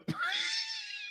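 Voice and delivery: breathless high shriek of laughter; helpless wheezing giggle; high squealing laugh. Real, not acted. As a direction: let the laugh fly up into a squeal. Cracks up at the punchline.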